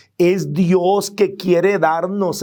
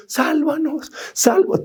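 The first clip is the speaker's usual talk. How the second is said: mock plea; 10 semitones higher than usual. Nobody was asking Jesus on the cross to save them, high mock plea. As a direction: imitate a crowd begging, pitched well up.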